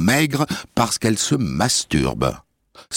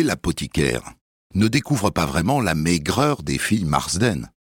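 normal talking voice

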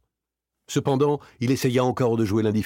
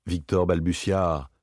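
usual speaking voice

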